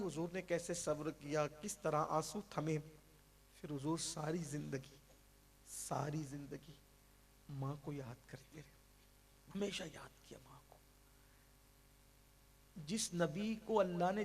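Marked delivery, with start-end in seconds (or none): 3.6-10.8 hushed mournful preaching; grieving softly. Acted. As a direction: let the voice sink with grief. Sermon tells of grieving Prophet.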